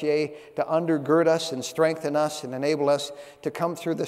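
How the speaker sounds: low, earnest prayer voice. Acted. Pastor praying for the congregation, earnest and low. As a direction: pray it humbly before the sermon.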